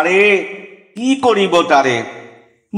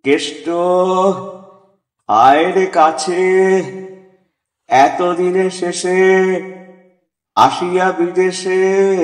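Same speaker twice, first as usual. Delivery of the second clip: piteous, feeble plea. Acted. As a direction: call weakly for help from the sickbed. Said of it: "ডাকি নিশিদিন সকরুণ ক্ষীণ". (করুণ ক্ষীণ ডাক)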